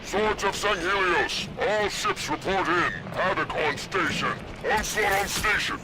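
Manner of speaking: commander over the radio, steady orders. Acted. Arbiter commanding his fleet over the radio, steady and firm. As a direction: call the ships in over comms.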